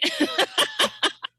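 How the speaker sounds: very high mimicked cackle; put-on tipsy cackle; hammered-sounding cackle. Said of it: "it sounds like she's hammered".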